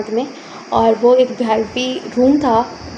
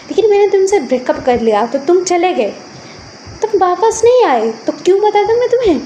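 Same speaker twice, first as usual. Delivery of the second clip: rising, higher-pitched accusation; defensive quarrelling; 7 semitones higher than usual. Acted. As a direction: argue back, hurt and heated. Former couple quarrel over child. (झगड़ते हुए उलाहना)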